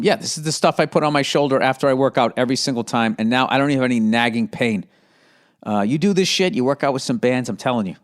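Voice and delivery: ordinary talk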